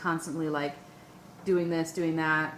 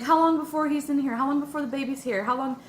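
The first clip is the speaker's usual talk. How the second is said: anxious pleading; 8 semitones higher, 4 dB louder than usual. Retold or imitated. Freaking out demanding answers.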